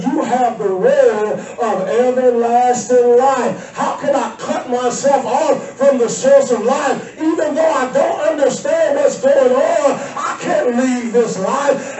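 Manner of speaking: fervent plea. Acted. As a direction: cry it out as Peter clinging to the source of life. Peter's plea voiced at full force.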